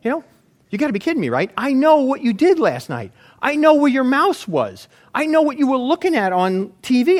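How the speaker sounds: high, loud incredulous rant; hammed-up disbelieving tirade; mock outrage. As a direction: play him indignant and disbelieving. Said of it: "then he would say".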